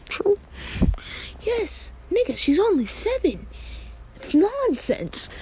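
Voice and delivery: hammed-up high-pitched voice; high character voice. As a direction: squeak it out like a different character. Character voiced in retold anime scene.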